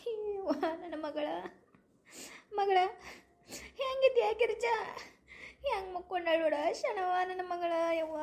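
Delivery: tearful wailing. Acted. Mother sees her daughter lying in bed, weeping. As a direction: lament brokenly between sobbing pauses. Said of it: "ಏನಕ್ಕೆ ಅಳ್ತಿದ್ದೀರಾ?".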